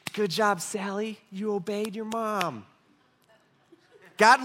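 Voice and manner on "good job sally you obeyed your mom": soft, slow, slightly raised praising voice; put-on pat on the head; mock praise. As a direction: praise a child slowly and softly, in mock approval. God's imagined praise, mocked as false.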